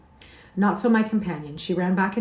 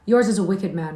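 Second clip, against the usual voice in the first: retorting; 7 dB louder than usual. A narrator's reading. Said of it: "retorted Catherine".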